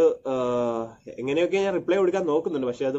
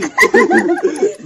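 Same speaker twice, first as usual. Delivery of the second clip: shrieking laugh, loud and high; loud high laughter burst; 12 dB louder than usual. Real, not acted. Laughs at wrong ice cream answer.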